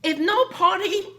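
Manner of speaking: loud, high retold scolding with a laugh in it; angry scolding. Miss Wang scolding Tina for the wrong answer, loud and high. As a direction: scold her like she's lost her mind. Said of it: "Miss Wang got very angry".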